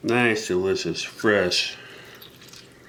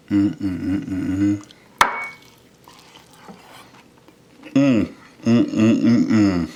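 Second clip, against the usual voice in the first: savoring mmm while eating; 3 dB louder, slower than usual. Real, not acted. Tasting the crab cake.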